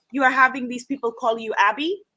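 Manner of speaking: put-on measured, emphatic Igbo-accented challenge; bad Igbo teacher accent. Igbo teacher confronting a student about her nickname, badly imitated accent. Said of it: "for doing a very bad accent".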